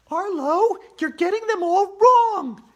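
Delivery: put-on high, loud girl's voice, not whispered; Andrea whispering. A narrator's reading. Andrea scolding her teammate, high and loud. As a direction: scold him as the upset girl, pitched high. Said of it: "Andrea whispered to me".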